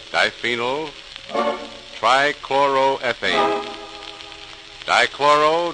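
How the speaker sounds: usual speaking voice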